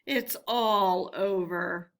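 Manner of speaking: slow, hopeless murmur in Toad's voice; murmuring to himself. A narrator's reading. Toad murmuring to himself on the road, slow despairing murmur. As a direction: mutter it slowly, as if giving up. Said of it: "murmuring to himself".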